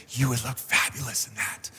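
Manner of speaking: whispered temptation. Retold or imitated. Pastor voicing Satan tempting the wife at the store, in a hushed sly whisper. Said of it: "Satan kinda whispered into me".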